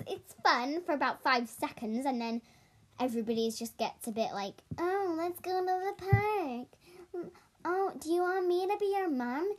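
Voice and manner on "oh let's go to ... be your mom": put-on high, slow childish whine; whiny little kid act; mocking kid voice. Mocking kids who play Brookhaven.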